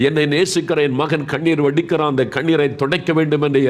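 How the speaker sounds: impassioned preacher addressing the suffering; fervent preaching. Acted. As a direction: press on urgently, phrase after phrase. Preaches compassion, voices God's lament.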